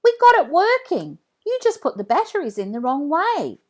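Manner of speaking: put-on high, loud, happy kid voice; excited calling. A narrator's reading. Billy shows off the working robot dog, excited. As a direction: brag about fixing it.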